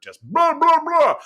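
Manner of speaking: high, loud blah blah blah; blah-blah noise. Retold or imitated. Host mimicking a hectic Monday at work, loud high blahs.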